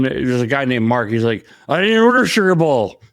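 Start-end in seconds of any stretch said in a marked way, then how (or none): 1.7-3.0 high, raised protest; mock indignant denial; protesting prank victim. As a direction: imitate a baffled guy objecting from afar. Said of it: "you could hear him saying".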